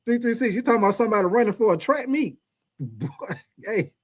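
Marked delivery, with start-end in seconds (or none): none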